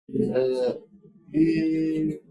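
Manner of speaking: "Eh" and "y" each held long and even; continuous sounds. Shown as a demonstration. Teacher explaining why they are called continuous, sustained sounds. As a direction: sustain "Eh", then "y", without breaking. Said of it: "consonantes continuas".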